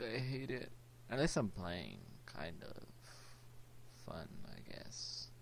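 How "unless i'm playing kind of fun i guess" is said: ordinary delivery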